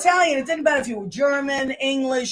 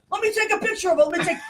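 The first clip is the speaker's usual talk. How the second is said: higher-pitched eager pleading; eager clamouring; 7 semitones higher, much faster than usual. Retold or imitated. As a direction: mimic friends begging for a photo. Straight friends clamour for photo.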